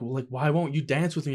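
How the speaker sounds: usual speaking voice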